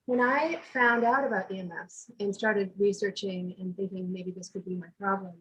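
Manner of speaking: normal talking voice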